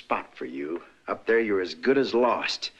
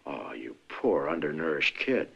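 mock pity; 8 semitones lower, 4 dB quieter than usual. Mock pity at her hunger.

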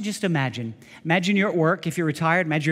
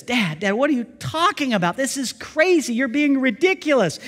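higher, disbelieving son; a hint of an incredulous son; 8 semitones higher, 4 dB louder than usual. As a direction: push back in disbelief. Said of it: "The son says".

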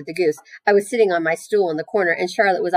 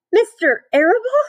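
high-pitched, louder wail of disbelief; sobbed question; 6 dB louder than usual. A narrator's reading. Wilbur hears who is in the plot, sobbing. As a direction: sob it out as a piglet's squeal. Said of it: "sobbed Wilbur".

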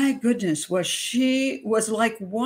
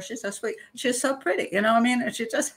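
ordinary talk, nothing marked

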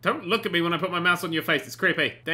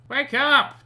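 shouting; 6 semitones higher, 6 dB louder than usual. Real, not acted. Yelling at sleeping sims.

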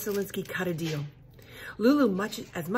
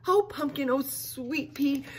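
tearful dad; 7 semitones higher, slower than usual. Acted. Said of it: "her dad said".

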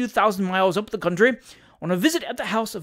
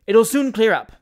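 upbeat, louder reassurance; cheerful tone; 4 semitones higher, 8 dB louder than usual. A narrator's reading. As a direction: brush the rain off cheerfully. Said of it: "in a cheerful tone".

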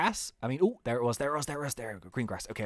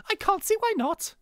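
much higher-pitched put-on young voice. Young-looking game character agreeing to questions, high voice. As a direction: pitch it right up for a young guy. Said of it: "we'll do, like, quite a high voice".

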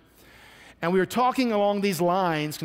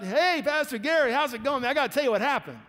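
excited greeting; 7 semitones higher than usual. Retold or imitated. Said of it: "He comes up".

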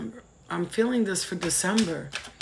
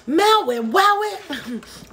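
much higher, louder stoner delight; Dave Chappelle excited; 11 semitones higher, 6 dB louder, much slower than usual. Retold or imitated. Tarot reader quoting a stoner movie, high and giddy. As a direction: squeal it like finding a stash. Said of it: "I felt like, um, Dave Chappelle".

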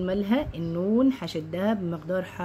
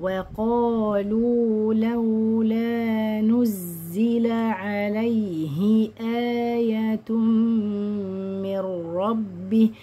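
slow, drawn-out tajweed chant; Quran recitation; 3 semitones higher, much slower than usual. Shown as a demonstration. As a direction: recite with long held vowels and nasals.

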